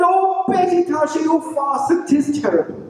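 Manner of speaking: high, fretting husband; high distressed voice. A narrator's reading. Francie tells Betty the news, high and fretting.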